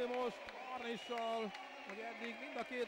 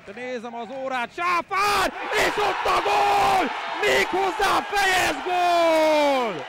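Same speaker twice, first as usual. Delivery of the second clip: loud, slow goal roar; excited Hungarian sports commentary; 7 semitones higher, 21 dB louder, slower than usual. Acted. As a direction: bellow the goal, drawing it out.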